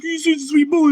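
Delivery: high doting coo at the dog; cooing pet voice. Retold or imitated. Owner babying his little dog on his lap, high coo.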